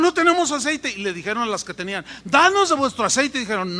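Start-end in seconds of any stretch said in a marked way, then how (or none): 0.0-0.9 high, louder voice of alarm; acted foolish virgins. 2.2-3.4 high, louder urgent request; acted foolish virgins pleading. Acted foolish virgins begging for oil.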